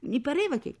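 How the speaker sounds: high, faltering start cut off; flustered stammer. A narrator's reading. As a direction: begin a hesitant excuse. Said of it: "balbettava papà". (scusa balbettata)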